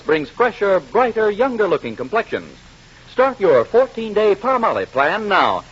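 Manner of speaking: ordinary delivery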